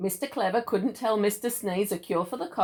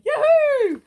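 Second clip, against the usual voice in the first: high, loud yahoo of delight; squealing yahoo at toast time; excited whoop; 9 dB louder than usual. Pretend. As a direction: whoop it out like a thrilled kid. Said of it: "I'd wake up going".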